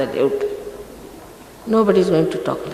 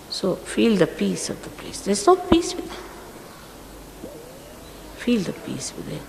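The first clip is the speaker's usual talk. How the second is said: much softer, half-whispered invitation; hushed guidance; 10 dB quieter, slower than usual. Real, not acted. Guru urging disciples to feel the peace, softly hushed. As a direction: draw them inward softly.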